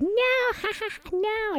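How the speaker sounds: put-on high, giggly child's no between laughs; giggling child. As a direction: squeal it out through giggles like a tickled kid. Daughter laughing while being tickled.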